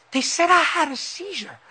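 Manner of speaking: high voice of the wife; imitated wife. Wife tells husband what doctors said, imitated high voice. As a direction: mimic her, pitched well up.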